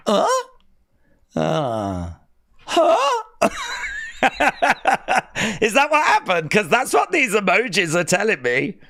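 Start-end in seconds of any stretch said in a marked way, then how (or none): 0.1-3.2 imitating shock. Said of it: "You went". 3.3-5.5 laughter. Real, not acted. Laughing at the emojis.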